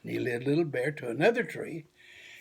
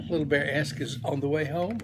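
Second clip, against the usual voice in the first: plain reading voice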